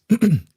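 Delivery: sharp high throat clear. Real, not acted. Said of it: "If you hear me clearing my throat".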